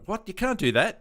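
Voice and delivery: playfully indignant outburst; mock outrage. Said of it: "was like, was like".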